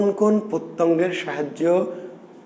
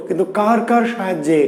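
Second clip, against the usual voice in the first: ordinary talk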